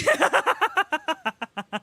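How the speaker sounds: high, loud laugh tailing down; laughing fit. Real, not acted. Laughing after her strained song, loud burst.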